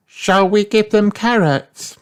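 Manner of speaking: put-on high-pitched squeaky child voice; little rabbit. A narrator's reading. Rebecca asking about the ducks, squeaky rabbit voice.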